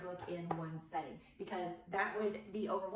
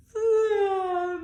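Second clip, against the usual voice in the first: long high yawn, much louder; flamingo yawn; 11 semitones higher, 12 dB louder, slower than usual. Shown as a demonstration. Teacher showing toddlers a flamingo yawn, high and long. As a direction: stretch out a big squeaky yawn. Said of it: "she yawns like this".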